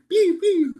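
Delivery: high-pitched "pew, pew" laser sounds; playful zap noises. Pretend. Teacher illustrating fire from heaven, playful zaps. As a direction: imitate a toy ray gun.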